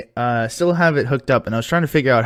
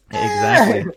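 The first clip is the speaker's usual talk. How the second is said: sarcastic. Pretend. Sarcastic agreement about being lazy.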